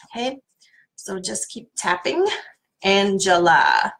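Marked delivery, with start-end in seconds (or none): 2.8-3.9 Angela said slow and a bit louder; slow sounding of the name Angela; drawn out. Shown as a demonstration. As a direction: stretch out Angela. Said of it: "And have fun with it".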